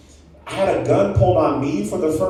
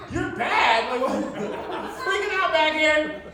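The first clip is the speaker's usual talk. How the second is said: high-pitched incredulous freak-out; comic panicked protest, up high; retold panic; 11 semitones higher, 5 dB quieter than usual. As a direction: squeal back at the pilot in disbelief. Panicked passenger retorts to pilot.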